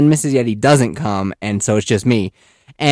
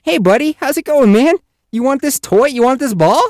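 put-on high, loud, playful kid-friendly voice; bouncy high-pitched greeting; talking to toddlers; 13 semitones higher, 5 dB louder than usual. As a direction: offer it eagerly to a little kid.

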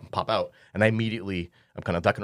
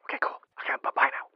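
whispering; 4 dB quieter, much faster than usual. Acted. Hanging up, still hiding.

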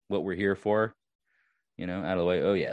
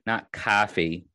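normal talking voice

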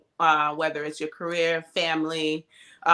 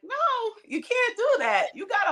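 high-pitched retold rebuke; mock scolding; faster than usual. Partner calling out her deflecting, mock scolding. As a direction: imitate a partner refusing the excuse.

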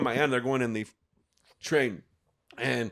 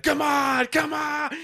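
high, raised mock yell of a furious aunt; mad aunt; 12 semitones higher, much slower than usual. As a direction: imitate an angry aunt egging on a fight. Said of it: "gets mad and gets in the middle".

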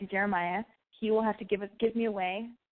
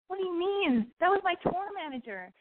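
acted incredulous correction; 11 semitones higher than usual. Boyfriend corrects her, acted out.

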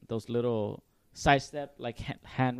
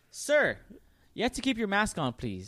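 much higher, politely firm; polite waitress voice; 6 semitones higher than usual. Retold or imitated. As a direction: play a waitress correcting a customer. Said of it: "the waitress would be like".

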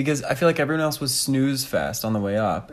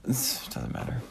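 whispered; 11 dB quieter, faster than usual. Real, not acted. Dodging question about ex.

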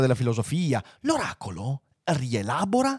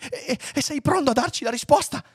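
stammering high-pitched question; anxious; 13 semitones higher than usual. A narrator's reading. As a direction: stumble eagerly into asking. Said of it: "domandò ansioso Lunk Walvl". (domanda ansiosa e balbettante)

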